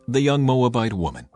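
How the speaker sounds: ordinary delivery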